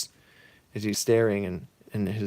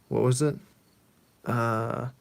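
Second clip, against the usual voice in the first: ordinary delivery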